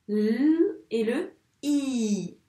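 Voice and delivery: L held as "lll", I as long "ee"; isolated sounds. Shown as a demonstration. Teacher splitting a syllable into sounds, drawn out. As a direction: slowly sustain the sounds L and I. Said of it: "Quels sons tu entends".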